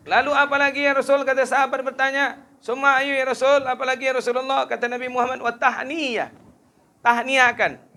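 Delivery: high, loud impassioned preaching voice; fiery preaching. Acted. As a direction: lift the voice and declaim to the crowd. Preaching at full force.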